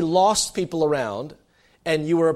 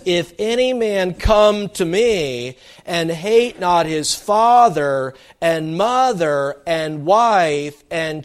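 slow, louder and weighty, each phrase laid down; solemn scripture recitation; 4 dB louder, slower than usual. Acted. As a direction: proclaim it like a scripture reading, slowly. Preacher reciting Jesus' words.

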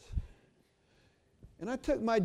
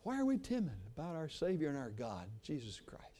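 ordinary talk

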